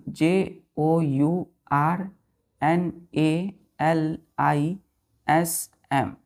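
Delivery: each letter spaced out slowly for dictation; teacher spelling a word slowly so children write it down. Shown as a demonstration. Teacher dictating letters to children, slow and spaced. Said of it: "teacher spells word letter by letter".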